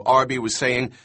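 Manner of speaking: ordinary delivery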